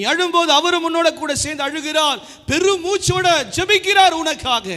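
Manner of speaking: fervent preaching. Real, not acted. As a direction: build to a passionate plea.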